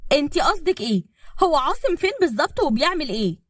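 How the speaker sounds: high-pitched, sharp demand. A narrator's reading. Fiancée questioning his mother about his whereabouts, sharply. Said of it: "وأسيف قالت بحدة".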